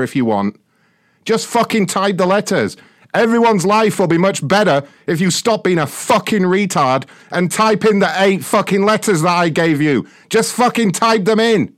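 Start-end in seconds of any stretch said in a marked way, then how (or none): 1.2-11.7 angry ranting. Real, not acted. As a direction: shout it in exasperation. Ranting at caller.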